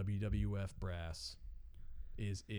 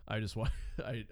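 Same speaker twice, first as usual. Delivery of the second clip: normal talking voice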